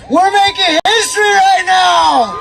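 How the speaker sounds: rally the crowd with it; proud cry to a cheering crowd; rousing rally shout. Real, not acted.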